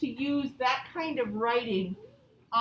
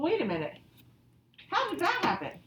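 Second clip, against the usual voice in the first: ordinary delivery, nothing marked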